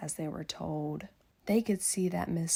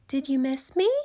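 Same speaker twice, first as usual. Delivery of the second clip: higher, lightly teasing question; playful teacher; 7 semitones higher, 7 dB louder, faster than usual. Acted. Miss Nelson teasing the kids on return, higher and light. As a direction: ask it with a smile.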